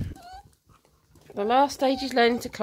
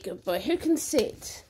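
usual speaking voice

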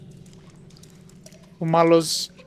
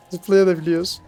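ordinary delivery

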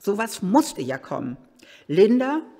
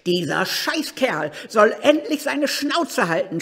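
higher and louder furious yell; angry scream; 6 semitones higher, 6 dB louder than usual. Acted. Annemarie yelling at the old man, furious shout. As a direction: lash out at him, loud. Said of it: "schrie sie ihn an".

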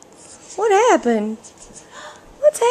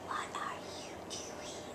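whisper; 25 dB quieter than usual. Real, not acted. Parent whispering to the baby in the crib. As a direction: hush it right down.

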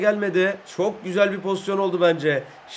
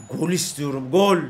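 ordinary delivery

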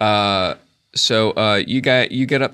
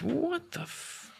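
high, slow, hushed disbelief; drawn-out quiet bewilderment, cut off mid-word; baffled giant; 13 dB quieter, slower than usual. Acted. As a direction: trail off in soft, high-pitched astonishment. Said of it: "and he kinda goes".

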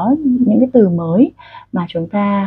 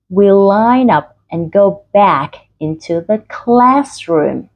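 very slow, stretched drill reading; slow, exaggerated articulation; much slower than usual. Shown as a demonstration. Teacher wants learners' jaws to tire, slow exaggerated articulation. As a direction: work the jaw hard on every word. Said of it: "các bạn phải cố gắng chuyển động làm sao".

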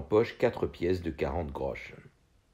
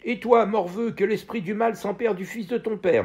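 high, loud urchin jeering; kid's yelled insult; 14 semitones higher, 5 dB louder than usual. A narrator's reading. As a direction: yell the curse across the street like a street kid. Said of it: "me cria un gamin". (un gamin crie une insulte au petit garçon, fort et aigu)

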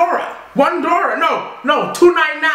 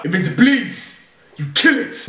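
Arnold Schwarzenegger; 3 semitones lower than usual. Acted. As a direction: drop down into a mock Austrian accent. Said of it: "Arnold Schwarzenegger".

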